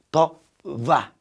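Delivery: 더 와 very slowly, syllable by syllable; 더, 와 with light lips, very slow; say it after me. Shown as a demonstration. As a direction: model 더, 와 for students to repeat. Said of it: "따라해봐".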